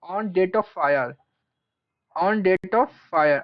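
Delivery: very slow, measured note-giving; slow dictation. Real, not acted. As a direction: stretch each word while writing. Dictates while writing it out.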